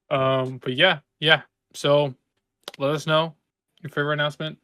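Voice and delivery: ordinary talk, nothing marked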